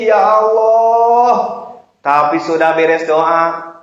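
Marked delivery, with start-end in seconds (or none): 0.0-1.3 loud, high, long-drawn call; mock tearful wail. Preacher imitates tearful prayer to Allah, wailing. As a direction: wail it out to God, stretched. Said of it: "Kita udah doa nangis-nangis".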